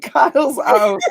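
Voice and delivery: laughing remark. Real, not acted. Host joking about postcards, laughing. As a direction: giggle through it.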